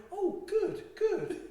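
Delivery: high, delighted "good, good"; gleeful, as if in on the joke; delighted bikers. Retold or imitated. Bikers pleased about Noah's Ark.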